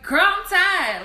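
loud, high, drawn-out exasperation; exasperated outburst. Retold or imitated. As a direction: let it burst out slowly, fed up. Venting about burnout to supervisor.

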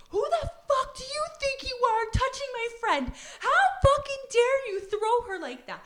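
high-pitched outraged tirade; angry confrontation. Retold or imitated. Friend defending her pal from a shover, high outraged yelling.

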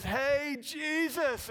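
loud, high eager call; excited calling out. Retold or imitated. Blind beggar hailing Jesus as he passes, excited call. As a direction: call out to get his attention. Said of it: "he's excited about it".

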